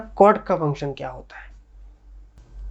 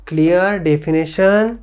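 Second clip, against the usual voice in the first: normal talking voice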